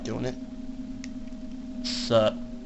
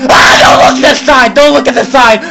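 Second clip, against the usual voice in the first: loud, high panicked shout; frightened yell at a teammate; 10 semitones higher, 6 dB louder than usual. Real, not acted. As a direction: scream the warning.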